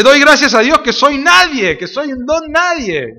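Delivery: a hint of Pablo disclaiming himself; voicing Pablo. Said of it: "Pero Pablo dice".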